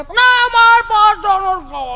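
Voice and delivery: put-on high, slow, whining child's refusal; whiny spoilt child. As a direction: drag it out like a pampered kid. (ઊંચા અવાજે ધીમું રડમસ બાળક)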